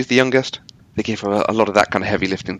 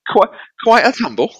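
very high posh English voice; hammed-up posh Brit; British accent; 12 semitones higher, 4 dB louder than usual. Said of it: "doing my Harry Potter again".

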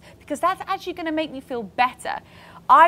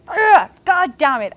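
exasperated, louder and higher, dragged out slowly; frustrated groan; 6 semitones higher, 6 dB louder, slower than usual. Retold or imitated. Fed-up inner voice on the beach shoot, exasperated groan. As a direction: let the frustration spill out on the ugh. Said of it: "half of my brain is like".

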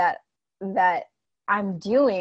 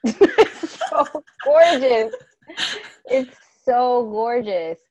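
slow, tearful laughing praise; teary laugh; 3 semitones higher, 6 dB louder, much slower than usual. Real, not acted. Coach moved by client's family story, slow teary laughing praise. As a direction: laugh through welling tears, drawn out. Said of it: "now I'm definitely gonna cry".

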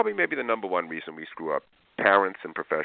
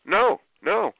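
emphatic no; 11 semitones higher, 5 dB louder than usual. Retold or imitated. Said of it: "I go".

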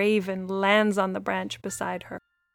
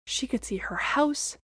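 whisper; 4 semitones higher, 5 dB quieter than usual. A narrator's reading. Awed view from treetop.

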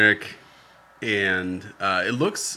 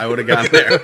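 louder, laughing through the protest; laughing insistence; 9 dB louder than usual. Real, not acted. Defending himself to teasing co-hosts, laughing and louder. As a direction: laugh it out while defending yourself. Insisting he'd have remembered the name.

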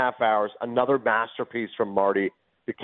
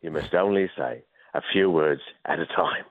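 Phil Hartman as Michael Caine; 6 semitones lower than usual. Retold or imitated. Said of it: "And he said".

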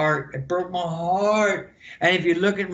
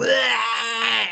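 mock battle yell; 4 semitones higher than usual. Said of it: "get up on the rope and go".

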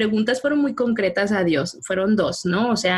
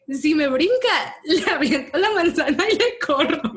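giggling through a retold threat; laughing while repeating her old plan; 6 semitones higher than usual. Laughing as she tells a friend about the apple.

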